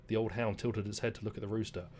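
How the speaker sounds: gentle storybook reading voice; story narration. A narrator's reading. Streamer reading a game fable aloud, storybook narration. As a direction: read it like a bedtime fable.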